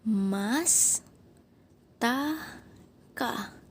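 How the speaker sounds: Mas, ta, ka with pauses between; syllable by syllable. Shown as a demonstration. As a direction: break Mastaka into three separate syllables. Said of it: "Begini, Nak".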